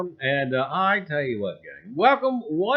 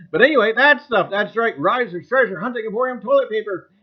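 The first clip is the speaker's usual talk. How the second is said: chipper 1950s ad; 3 semitones higher, 5 dB louder than usual. Pretend. Mock ad for store toilet paper.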